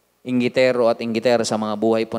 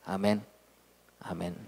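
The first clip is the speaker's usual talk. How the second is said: usual speaking voice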